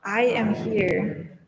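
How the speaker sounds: very slow, spaced words for the congregation to follow; slow call to repeat. Shown as a demonstration. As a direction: lead them word by word. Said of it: "Can you say that with me?".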